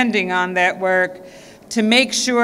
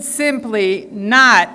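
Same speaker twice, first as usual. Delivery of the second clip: "not" slowed and pressed with weight; stress on not; slower than usual. Shown as a demonstration. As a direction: lean hard and slow on "not". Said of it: "and I emphasize the word not".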